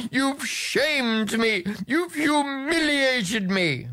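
a father wailing at his daughter; crying king. A narrator's reading. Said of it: "cried the king".

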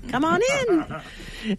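slow, drawn-out high welcome; welcoming. Real, not acted. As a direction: invite them in warmly. Welcoming visitors into studio.

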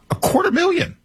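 very high-pitched, rising disbelief; incredulous exclamation. Real, not acted. Host driving home a shocking figure, incredulous. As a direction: squeak out the figure in amazement.

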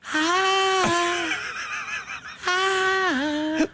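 high, long sung "ahh"; choir "ahh". Acted. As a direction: hold a high choir note. Imitates choir mentioned just before.

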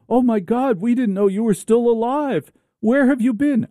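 high-pitched melodramatic astonishment; astonished drama character. Acted. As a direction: gasp it out like a hammed-up film reunion. Acting out a serious drama scene.

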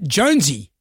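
mild mock yell; repeated name as a light call; imitated yelling out. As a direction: retell a woman calling her cat, without raising volume. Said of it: "a lady was yelling out".

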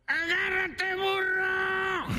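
high-pitched, stretched mock shout; tiny creature yelling from afar; ant calling out. Ant throws the donkey a rope, squeaky shout.

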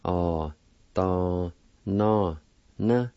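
"o" "do" "no" "na" sounded out one at a time; syllable by syllable. Shown as a demonstration. Teacher sounding out the second part, syllable by syllable. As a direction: give each syllable its own beat. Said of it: "Second part".

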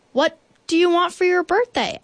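high, eager bear voice; bear's question. A narrator's reading. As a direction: ask it high and keen as Bear. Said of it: "asked Bear".

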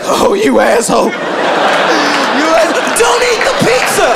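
high, strained mock protest; mock outrage. Pizza lover offered pizza, high mock outrage. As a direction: rise into a hammed-up indignant squeal.

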